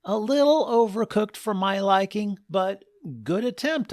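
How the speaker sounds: high, snooty critic voice; food critic voice. Acted. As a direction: judge the broccoli primly. Dad's fussy inner critique.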